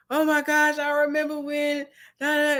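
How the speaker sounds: a touch of tipsy nostalgia; mock weepy reminiscing. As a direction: sigh into an old memory, lightly acted. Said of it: "you're crying".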